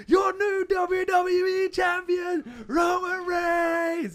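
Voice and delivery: slow, loud, drawn-out announcer call; ring announcer. Retold or imitated. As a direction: announce the champion to the arena. Ring announcer style proclamation.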